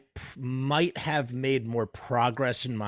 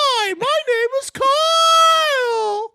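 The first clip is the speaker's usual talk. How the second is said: much higher, louder, slow, shouted strained voice; Kyle MacLachlan impression; 7 dB louder, much slower than usual. Friend shows his own version of Kyle, high and shouted. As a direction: stretch it out in a strangled high shout. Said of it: "Wait, do it again?".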